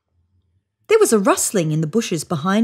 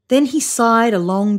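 ordinary delivery, nothing marked

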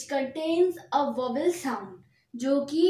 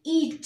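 ईट with a firmly stressed opening vowel; 2 semitones higher than usual. Shown as a demonstration. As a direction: put the effort on the vowel of ईट. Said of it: "ई पर बहुत ज़्यादा ही stress".

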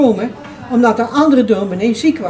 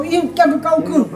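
higher, eager announcement of the prize; proud and thrilled; 6 semitones higher than usual. Retold or imitated. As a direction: show off the turkey gleefully. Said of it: "hij sprong een gat in de lucht". (trots en blij, hoger uitgeroepen)

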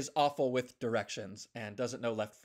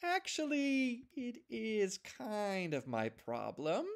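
higher and slow, softening; sheepish little dog; 7 semitones higher, 3 dB quieter, much slower than usual. Acted. Noob admitting to Chance, slow sheepish voice. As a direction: confess it with a shrug.

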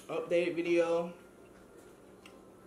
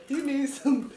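laughing; 7 semitones higher, 7 dB louder than usual. Real, not acted. Laughing at friend crying.

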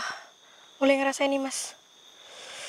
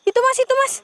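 alarmed cry; 10 semitones higher, 7 dB louder, faster than usual. Real, not acted. Pointing at the ghost, alarmed.

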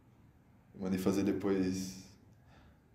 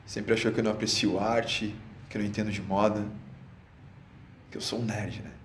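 hurt rebuke. Acted. Rejected man refusing his ex the gift, bitter reproach. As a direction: push each wounded accusation out firmly.

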